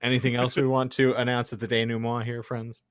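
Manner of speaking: normal talking voice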